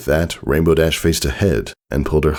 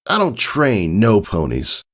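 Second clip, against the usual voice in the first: cold refusal; 8 semitones higher than usual. A narrator's reading. Mentor voiced coldly refusing.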